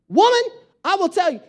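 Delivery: mock outrage, high and loud on the first word; indignant. Wife to a backtalking Alexa, indignant. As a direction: snap back in exaggerated indignation.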